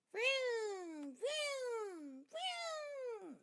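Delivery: high, loud revving vroom noises; motorcycle vroom. A narrator's reading. Fudge on his toddler bike makes loud motorcycle noises. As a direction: rev it like a little kid on a motorbike. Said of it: "he yells".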